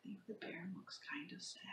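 whispering. Real, not acted. Gentle aside to children.

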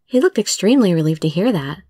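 plain reading voice

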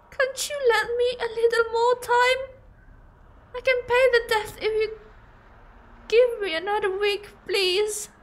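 high-pitched granny begging for time; tearful high granny plea; pleading old lady. Acted.

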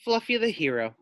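usual speaking voice

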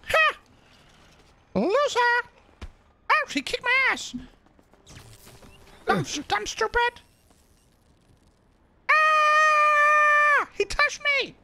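high, loud excited yells at the game; excited yelling. Real, not acted. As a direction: taunt and shriek mid-fight. Heated gaming moment.

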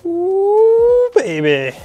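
high excited coo at the bike; delighted ooh. Real, not acted. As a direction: gasp of delight at the new bike. First look at new bike.

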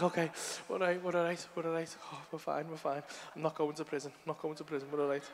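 soft, low, fading away; hushed self-reassurance. Retold or imitated. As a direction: murmur it to calm yourself down. Calming himself and the child, retold.

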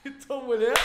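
ordinary talk, nothing marked

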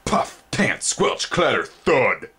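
high, thudding noise words; sound effects. A narrator's reading. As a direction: beat out each heavy footstep. Approaching monster noises.

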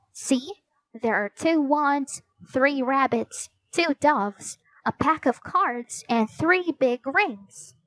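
put-on high young heroine, listing things piece by piece; Annie, eager girl. Narrator voices Annie listing props.

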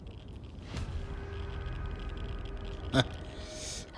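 soft unvoiced laughter; quiet laugh to oneself; breathy laugh. Real, not acted. Laughs at own remark.

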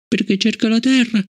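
put-on high young girl's voice, hurt and puzzled; a child asking why she is disliked; child Fallon. Fallon asking Bliss about Travis, high child voice.

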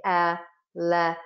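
elephant drawn out slowly, el-e-phant; a child copying "elephant" slowly, beat by beat; slow syllable by syllable. Shown as a demonstration. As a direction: stretch "elephant" into slow separate beats. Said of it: "They say".

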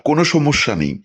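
ordinary delivery, nothing marked

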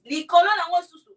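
emphatic repeat. Real, not acted. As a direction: hit it hard and proud the second time.

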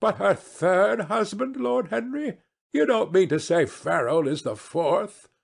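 high, astonished lady's voice; scandalised hostess, pitched well up; incredulous society hostess. Acted. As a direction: question him in lifted disbelief.

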